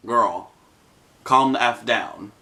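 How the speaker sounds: slow, unimpressed friend, lightly acted; mock deadpan calming. Friend to panicking girl in the store, coolly dismissive. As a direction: rein in a panicker, taking it slowly.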